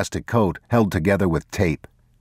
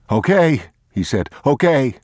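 high, loud giving up; defeated thief; 9 semitones higher, 4 dB louder than usual. Acted. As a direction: give in, beaten. Thief surrenders.

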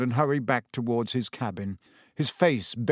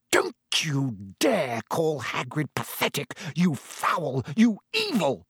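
furious build; 2 semitones higher than usual. Acted. Hermione turns on Malfoy, hissing and then shouting in fury. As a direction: start as a seething hiss, then blow up.

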